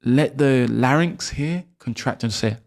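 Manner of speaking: ordinary talk, nothing marked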